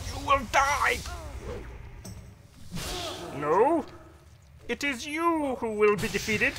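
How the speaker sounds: high, loud defiant war cry; battle shout. Acted. Paladin shouts defiance at his foe mid-fight. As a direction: throw the threat right back at him.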